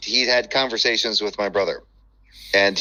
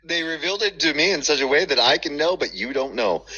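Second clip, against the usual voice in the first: higher, put-on smug voice; mock revelation; 6 semitones higher than usual. Co-host parodying presuppositionalist certainty, smug mock voice. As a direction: parody a believer claiming secret knowledge.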